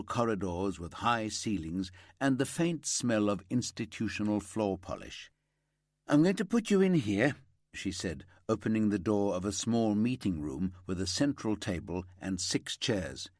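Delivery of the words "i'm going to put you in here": higher, louder kindly office worker voice; chatty section leader showing the detective in. A narrator's reading. Section leader ushering the detective in, higher and louder.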